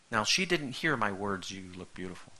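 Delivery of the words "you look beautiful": quiet affirmation. Shown as a demonstration. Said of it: "that's how I say it".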